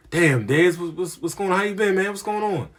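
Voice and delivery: mock old head delighted to see him; excited greeting.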